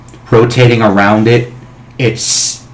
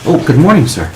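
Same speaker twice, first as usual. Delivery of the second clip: servant voice; 5 semitones higher, faster than usual. Acted. Servant greets master.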